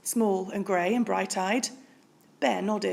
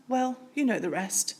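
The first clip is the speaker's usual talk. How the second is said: ordinary delivery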